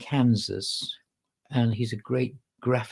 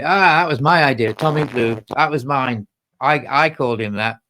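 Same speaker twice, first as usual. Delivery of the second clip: louder smug executive voice; mock smug boast; 8 dB louder than usual. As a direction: sneer like a credit-taking boss. Mocking executives claiming credit.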